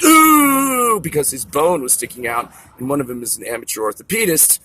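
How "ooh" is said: high, loud imitated "ooh" of pain; retelling a kid's painful injury cry, high and loud. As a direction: yelp it like hearing a bone snap. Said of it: "a noise that went basically".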